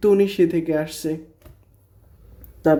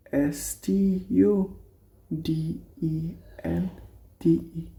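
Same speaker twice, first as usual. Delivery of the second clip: very slow, softer letter dictation; quiet, drawn-out dictation; slow spelling; 7 dB quieter, much slower than usual. Shown as a demonstration. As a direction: spell স্টুডেন্টে one letter at a time. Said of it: "spelling out".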